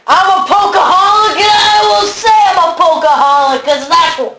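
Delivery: loud, high, laughing declaration; laughing proud shout. Acted.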